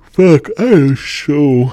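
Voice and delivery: loud stretched yawning apology. Real, not acted. Apologizing to listeners while yawning. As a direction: yawn it out loud.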